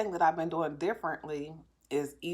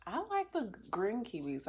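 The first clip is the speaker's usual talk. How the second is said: imitating daughter; 5 semitones higher, 4 dB quieter than usual. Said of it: "my daughter was like".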